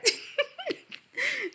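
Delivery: high-pitched burst of giggling; real giggle. Real, not acted. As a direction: crack up for a moment, high and light. Laughs at own remark.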